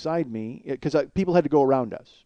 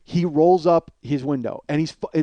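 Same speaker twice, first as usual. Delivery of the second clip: usual speaking voice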